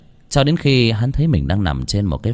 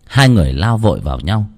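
ordinary delivery

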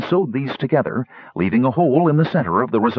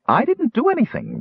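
high-pitched little gnome, insisting; 13 semitones higher than usual. A narrator's reading. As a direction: protest your innocence in a high gnome voice. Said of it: "Rainspot insisted".

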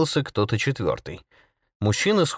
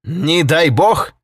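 indignant, loud, high exclamation; angry outcry; 7 semitones higher, 7 dB louder, much slower than usual. A narrator's reading. Holket seizes on an ordinary phrase, enraged and loud. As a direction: throw it back in outrage, drawn out. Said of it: "чтобы пробудить гнев Холкета".

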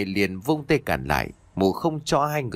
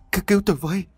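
desperate shriek for help; desperate scream; 8 semitones higher, much faster than usual. A narrator's reading. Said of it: "có tiếng la thất thanh".

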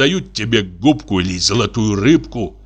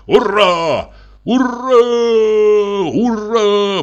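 cheering; 11 semitones higher, 3 dB louder, much slower than usual. Acted. Toast cheered at wedding table.

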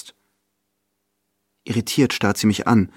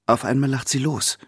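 usual speaking voice

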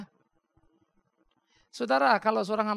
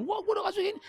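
mock grumble, pitched much higher; grumbling complaint; faster than usual. A stingy giver grumbling about his gift, in a high mock grumble. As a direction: whine it like a sulky giver who regrets it. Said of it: "kita ngedumel-ngedumel".

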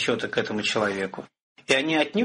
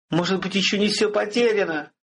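wailing; 7 semitones higher than usual. A narrator's reading. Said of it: "возопила Ренеперт".

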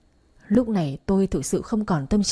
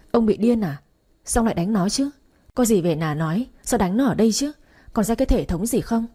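plain reading voice

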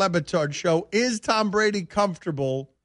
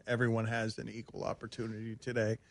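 much softer, lower incredulous echo of Everyone; soft lowered repeat, as if it can't be serious; quiet disbelief; 9 semitones lower, 14 dB quieter than usual. Real, not acted. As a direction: mutter the claim back, unconvinced.

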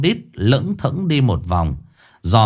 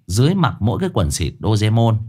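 ordinary delivery, nothing marked

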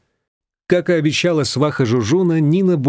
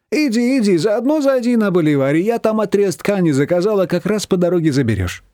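higher, brisk errand-giving voice; bossy mother-in-law; 7 semitones higher than usual. A narrator's reading. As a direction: send her off with another task. Manana sends Nina off.

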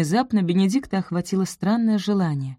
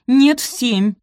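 high, loud insistent retort; flustered correction; 7 semitones higher, 7 dB louder, slower than usual. A narrator's reading. Sophie correcting sleepy Benedict, urgent insistence.

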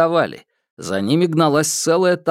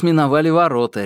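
usual speaking voice

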